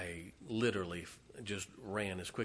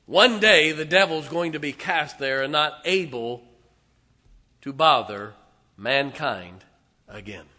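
loud, higher triumphant preacher's cry; triumphant preaching; 7 semitones higher, 16 dB louder than usual. Real, not acted. Preacher promising his church the devil's fall, triumphant. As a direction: ring it out in triumph.

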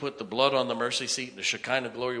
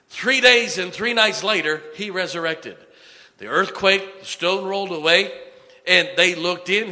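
raised, driving sermon voice; impassioned preaching; 6 semitones higher, 7 dB louder than usual. Real, not acted. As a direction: proclaim the story to the congregation. Preaches at full force.

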